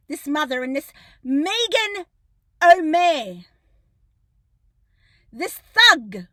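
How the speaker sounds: loud, indignant tirade; loud angry accusation; furious rant. Real, not acted. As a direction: let the outrage build at the magistrate.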